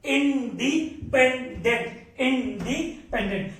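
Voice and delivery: "Independent" drawn out slowly, syllable by syllable; syllable-by-syllable drill. Real, not acted. Teacher drilling the term into students, slow syllabic delivery. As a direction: break independent into long, separate syllables.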